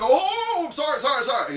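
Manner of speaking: high, loud flustered sorry; panicked apology. Retold or imitated. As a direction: blurt the apologies, fading as you calm.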